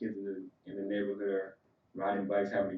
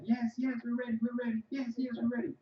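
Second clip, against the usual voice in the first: put-on high, squeaky kid voices calling back; excited kids answering; 14 semitones higher than usual. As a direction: shout it like eager little kids. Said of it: "We'd be like".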